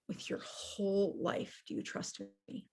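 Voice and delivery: barely voiced, choked with tears; a near-whispered plea through tears; tearful hush. To his mother at the hospital, a tearful near-whisper. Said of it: "with tears in my eyes".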